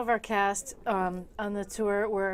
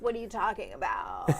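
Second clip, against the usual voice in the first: vocal fry; 7 dB quieter than usual. Retold or imitated. Castmate answering a plea to stop, vocal fry. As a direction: answer obliviously in a quiet fry. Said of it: "vocal fryer".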